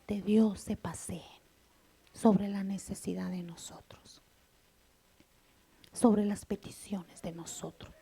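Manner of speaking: breathy hushed preaching whisper. Real, not acted. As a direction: drop to a whisper to draw them in. Intimate point in sermon. (predicando en susurro)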